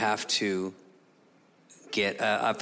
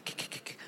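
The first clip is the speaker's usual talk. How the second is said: soft voiceless tongue clicks; scale clicking noise; 16 dB quieter, faster than usual. Retold or imitated. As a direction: tick the weight along the scale. Imitates sliding scale weights.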